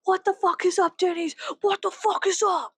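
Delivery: high, hollering hype greeting; rowdy shout-out. Acted. Greeting fellow rebel old ladies, rowdy holler.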